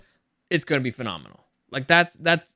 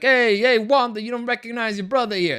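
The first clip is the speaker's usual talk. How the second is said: put-on Jersey-Italian accent; 6 semitones higher than usual. Fan imitating Pietro greeting his sister Wanda, mock Jersey-Italian accent. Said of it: "He sounds kinda like from Jersey or Italian".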